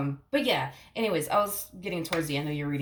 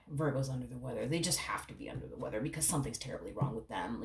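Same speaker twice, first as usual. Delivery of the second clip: mocking; 5 semitones lower, 8 dB quieter than usual. Mocking the worried partner.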